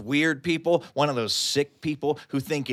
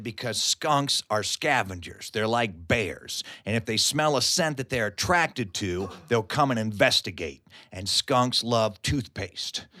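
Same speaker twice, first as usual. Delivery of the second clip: serious; 6 semitones lower, 6 dB quieter than usual. Retold or imitated. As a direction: explain it gravely, holding back laughter. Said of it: "as seriously as he could".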